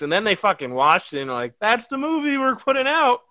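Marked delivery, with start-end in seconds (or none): 1.6-3.2 higher-pitched imitation of a pleased exec; lightly mocking, pitched well up; mock studio exec. Mocking studio execs approving the cut, put-on higher voice.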